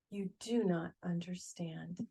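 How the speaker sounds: soft, resigned reply; quiet weary resignation. Acted. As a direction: say it quietly, giving up. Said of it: "The carpenter sighed".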